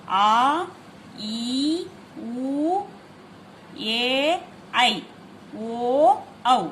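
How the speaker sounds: each vowel stretched long and slow; long vowels demo. Shown as a demonstration. Teacher shows students long vowels, drawn-out. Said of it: "उसे दीर्घ स्वर कहते हैं".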